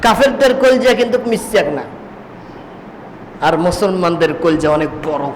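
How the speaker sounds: ordinary talk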